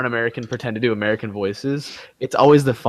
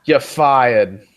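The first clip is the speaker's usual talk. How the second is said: bad British accent. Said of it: "a competition for the worst accent".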